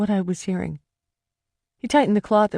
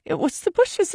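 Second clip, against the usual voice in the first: much higher, crying out; desperate cry; 12 semitones higher than usual. Acted. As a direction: cry it out, frantic to be believed. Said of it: "I cried".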